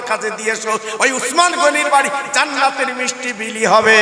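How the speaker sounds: loud chanted sermon with long drawn line endings; impassioned religious storyteller; full-force preaching. Acted. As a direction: preach it out, ringing and sing-song.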